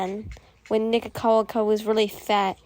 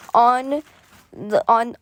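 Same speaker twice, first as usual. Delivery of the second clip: ordinary delivery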